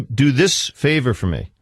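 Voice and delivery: normal talking voice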